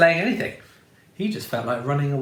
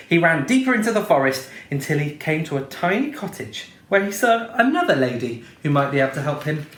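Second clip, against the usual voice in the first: plain reading voice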